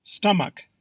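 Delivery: Stomach said STUM-uk; Stomach with "stum", ending in "uk"; short first vowel. Shown as a demonstration. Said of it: "Proper pronunciation is stomach".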